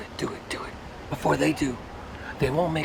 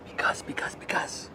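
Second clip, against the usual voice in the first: whispered, repeating because; whispering; 7 dB quieter than usual. Acted. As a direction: breathe each because as a whisper. Crazed whispered repetition.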